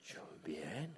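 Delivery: breathy whispered reply; barely voiced aside, no tone at all. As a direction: answer in a hushed whisper. His whispered reply to brother.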